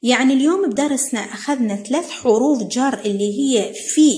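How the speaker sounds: ordinary talk, nothing marked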